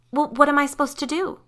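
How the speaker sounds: high, loud, rushed helpless question; panicked. A narrator's reading. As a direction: fire it off in exasperated alarm. Acted panicked teenager in story.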